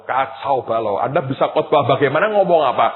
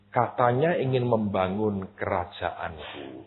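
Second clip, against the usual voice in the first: softer, slower, gentle and tender; gentle voice of God; 5 semitones lower, 7 dB quieter, slower than usual. Acted. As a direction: speak as a gentle God correcting him softly. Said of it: "hadir dengan begitu lembut".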